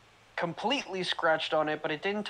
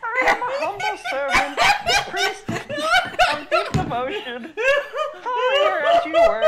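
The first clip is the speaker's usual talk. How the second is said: laughing through a humble priest voice; breaking up mid-line as the priest; 9 dB louder than usual. Acted.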